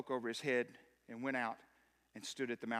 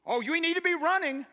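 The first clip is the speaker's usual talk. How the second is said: higher, louder fervent preaching; a preacher at full force calling the church to stop running; 13 semitones higher, 13 dB louder than usual. Acted. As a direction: drive it home to the congregation. Preaching climax to congregation.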